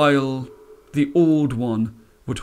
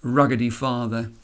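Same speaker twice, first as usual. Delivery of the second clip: ordinary delivery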